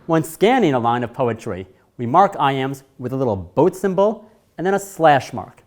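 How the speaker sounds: ordinary delivery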